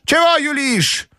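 very high, drawn-out challenge; angry grandmother. A narrator's reading. Grandmother challenging the fawning mademoiselle, high and angry. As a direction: draw it out as a high-voiced, cross old lady. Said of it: "Бабушка неистово кричала на них".

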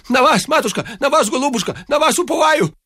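rapid-fire high-pitched entreaty; pattering plea. A narrator's reading. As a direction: gabble it high, one plea tumbling after another. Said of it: "поклонился в ноги и зачастил". (зачастить тонким умоляющим голосом)

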